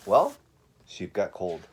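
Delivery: usual speaking voice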